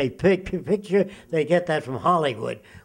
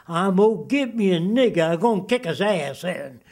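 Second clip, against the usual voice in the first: put-on Southern accent. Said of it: "speak with a Southern accent".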